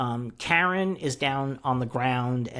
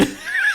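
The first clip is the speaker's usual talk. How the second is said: high yelp of a laugh; laughing at the gore; 4 dB louder than usual. Real, not acted. Laughs at muzzle flash.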